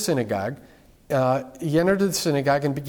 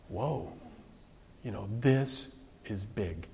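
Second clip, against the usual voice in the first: low, soft, slow amazement; hushed awe; 6 semitones lower, 10 dB quieter, slower than usual. Retold or imitated. Preacher voices the crowd reacting to Jesus, hushed awe. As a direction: let it sink in, quietly stunned.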